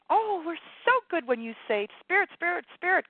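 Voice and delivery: high, loud, gleeful flutter; flitting spirit voice. Acted. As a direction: flit along lightly, pitched right up. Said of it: "it's kind of flitting".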